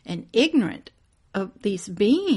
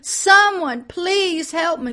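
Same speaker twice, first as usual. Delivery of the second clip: loud, high, pleading screams; desperate cries for help; 7 semitones higher, 10 dB louder, slower than usual. Retold or imitated. Preacher voicing the trapped souls crying for help. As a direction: cry out like souls trapped in flames. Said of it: "I could hear the screams and the cries".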